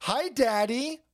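put-on slow high little boy voice; sweet whiny kid greeting daddy; childish voice. Voicing Hunter on phone.

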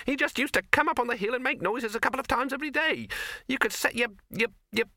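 very high, huffy rabbit, tailing into hesitation; rabbit character. Acted. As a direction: squeak it dismissively, then get stuck.

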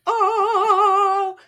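high, sung, dreamy oh; blissful dog oh. Retold or imitated. Dog soaking up the sunshine, a high sung oh. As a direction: croon it like a dog basking in sunshine.

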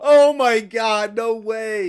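loud, slow stunned disbelief. Real, not acted. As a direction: stretch it out in shock.

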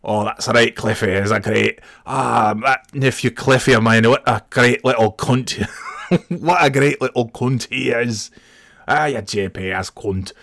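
a laughing take on a Scottish brogue; Scottish accent. Retold or imitated. Nephew mimicking his Scottish uncle, playful Scottish accent. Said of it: "Scottish Uncle Cliff".

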